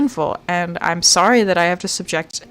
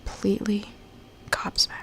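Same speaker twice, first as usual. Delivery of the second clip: whisper; 11 dB quieter than usual. Real, not acted. Exasperated search, whispering.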